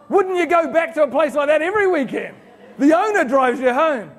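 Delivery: loud, high and laughing; excited disbelief. Real, not acted. Speaker laughing at the owner driving drunk customers home, loud and high. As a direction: marvel at it with a big laugh.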